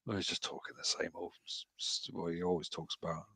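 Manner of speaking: muttered under the breath, dismissive; quietly grumbling to himself about a rival streamer; mumbled inner thought. Retold or imitated. Streamer muttering his thoughts about a rival, dismissive and quiet.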